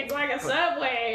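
mock southern accent. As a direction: playfully echo it in a southern accent. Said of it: "doing a southern accent".

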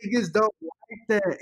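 high, loud disbelief; shocked exclamation. Real, not acted. Reacting to the confession.

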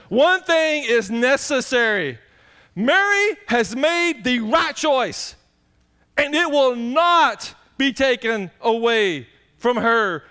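slow, loud scripture reading leaning on "not"; slow emphasis. Acted. Preacher reading Jesus's verdict to the church, slow emphasis. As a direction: read each word slowly and firmly. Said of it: "preacher stressing scripture".

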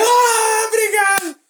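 high, loud squeal of gratitude; gushing thanks. Retold or imitated. Thanking a star who gave them the finger, gushing. Mock thanks after rude gesture.

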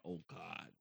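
low, soft imitated groan; exasperated dread. Wife in the back watching her husband show off, exasperated. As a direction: groan it under your breath, low.

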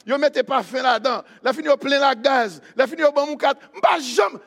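hammed-up excited rave, high and loud; amazed customer. Delighted garage customer telling church friends, excited rave. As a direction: gush loudly through each perk in disbelief.

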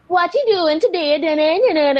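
put-on caricatured Jamaican accent tailing into da-da-da; mock Jamaican accent. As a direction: lilt it like a bad mock Jamaican. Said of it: "he ended up doing, like, a Jamaican accent".

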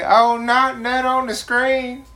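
high, loud, slow mock exclaim; joking outburst. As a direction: belt out the silly joke. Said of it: "I was about to say".

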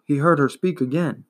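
usual speaking voice

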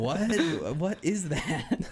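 high, laughing disbelief; mock bafflement through a laugh; baffled. Prince reacts to mermaid's accent, high and baffled.

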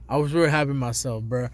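ordinary talk

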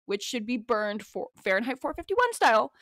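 ordinary talk

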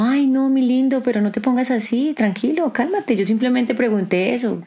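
sweetly calm down an angry man. Retold or imitated. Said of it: "Andrea es como". (Andrea calma a alguien enojado, voz dulce y aguda)

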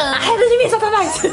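high, laughing rebuke; laughing cry to drive him off; shouted rebuke. Acted. (repreensão gritada rindo)

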